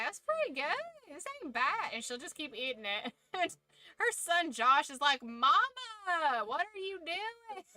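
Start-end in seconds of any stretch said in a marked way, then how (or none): 0.0-1.9 high, cheerful mock approval; imitating Mama. Mom praising terrible food, high chirpy imitation. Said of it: "But she is like". 5.2-7.6 put-on lightly done Southern accent, son's disbelief; a hint of a Southern son calling out his mom. Son Josh questioning Mama's cooking, light Southern accent. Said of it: "they get that, like, Southern accent".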